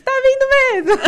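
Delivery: high, loud, slow amazement; stunned loud confirmation; astonished doctor. Retold or imitated. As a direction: stretch out the doctor's surprise. Doctor sees baby arriving.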